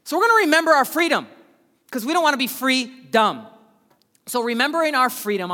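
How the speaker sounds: loud, high, fast preaching with "freedom" ringing out; full-force preaching. Acted. Pastor preaching his freedom pun to the church. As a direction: preach it to the back row.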